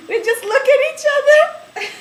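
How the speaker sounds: high, loud, laughing squeal. Real, not acted. Recalling silent library dates, laughing hard. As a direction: let the laugh take over.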